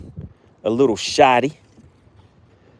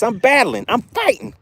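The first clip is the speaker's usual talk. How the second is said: high, hammed-up fighting fish; 10 semitones higher than usual. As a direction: squeak it out like a fish fighting for its life. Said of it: "The fish is like".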